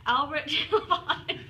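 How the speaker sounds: laughing through the name; slow reading that collapses into a laugh; cracking up. Real, not acted. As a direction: break into laughter mid-name. Cracking up in a blooper.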